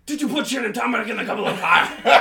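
high, loud mock Dumbledore, laughing through it; mock-furious headmaster grilling a student; Dumbledore quote. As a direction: shout it like a frantic Dumbledore. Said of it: "how did Dumbledore say it?".

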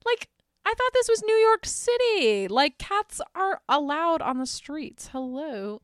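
high, indignant sarcastic aside; mock-indignant New Yorker; mock outrage. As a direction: act scandalized on the cat's behalf. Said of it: "And I'm like".

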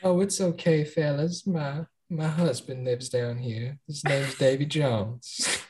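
Southern Belle voice. Acted. Reassuring sailors about her undersea husband, Southern Belle voice. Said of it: "that was dead on".

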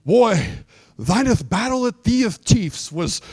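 lightly hammed-up old-English; a hint of pompous thee-and-thou; mock King James. Preacher mocking how people talk in King James English, lightly hammed. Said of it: "don't even know how to talk like that".

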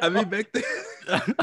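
high, drawn-out echo breaking into laughter; laughing mimicry. Friend echoing the detainee's promise, laughing. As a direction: mimic the punchline while cracking up. Listener echoes punchline while laughing.

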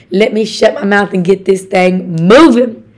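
normal talking voice

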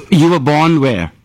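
ordinary delivery

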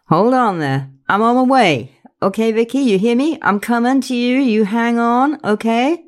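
urgent but comforting friend; steady her, firm and kind; reassuring. A narrator's reading. Faye comforting Vicky by phone.

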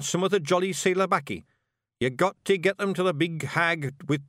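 brisk Scots chieftain giving orders; Rob Anybody commanding. Acted. Rob briefing his brother for the raid, brisk and bossy. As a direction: rattle off the orders.

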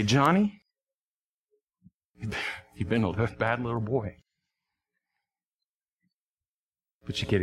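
soft, low, tender reassurance; gentle and tender. Pretend. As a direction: speak softly to a child who misbehaved. Gentle gift speech to a child.

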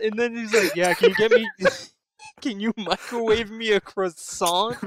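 loud, laughing through the quote; can barely get the words out for laughing. Real, not acted. Mocking the doctor's croissant order, laughing loudly.

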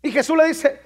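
ordinary talk

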